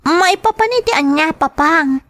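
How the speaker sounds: put-on a little girl fussing over too much food; child voice. Child complains to father about the food.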